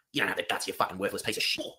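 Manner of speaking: low, contemptuous goading; menacing contempt. Acted. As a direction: drop your voice low to needle them. Goading trainee into punching him.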